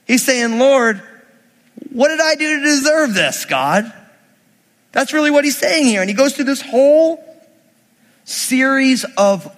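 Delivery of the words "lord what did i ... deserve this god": high-pitched mock protest to God; upset protest. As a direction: plead like a wronged Moses, lightly hammed-up. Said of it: "now Moses is upset".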